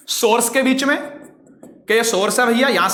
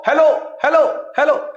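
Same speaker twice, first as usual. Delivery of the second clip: hello called out and repeated; echoing hello; echo demo; 4 semitones higher than usual. Shown as a demonstration. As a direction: shout hello like hearing it echo back. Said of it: "पूरा word सुनाई देगा".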